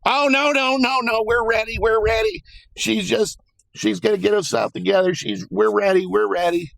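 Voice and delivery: high mocking falsetto of a flustered lawyer; high-pitched mock lawyer voice. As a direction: squeak it out like a panicking lawyer. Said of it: "her lawyer's like".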